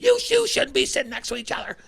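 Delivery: high, loud, scolding principal voice; stern principal. Retold or imitated. Principal finding the two fighters side by side, scolding. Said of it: "he goes".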